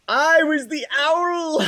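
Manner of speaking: high, slow proud declaration; excited. Real, not acted. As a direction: announce it like a triumph. Repeating the reveal.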